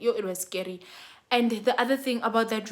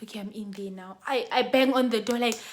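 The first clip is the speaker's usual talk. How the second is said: ordinary delivery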